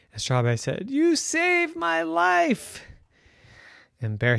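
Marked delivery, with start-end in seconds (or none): 0.9-2.8 high, slow thankful voice; grateful. Acted. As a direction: thank the hero tenderly. Unicorn thanks bear.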